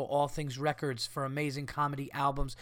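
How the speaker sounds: ordinary delivery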